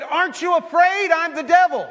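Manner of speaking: high, loud, exasperated shout; insistent mock devil. Costumed wife presses unimpressed husband, loud exasperated insistence. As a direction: demand that he be scared of you.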